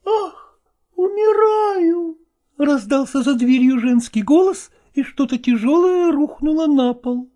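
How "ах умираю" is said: high, slow, swooning cry; high swooning lady; fainting cry. A narrator's reading. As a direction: wail it high and faint away. Bride faints behind door.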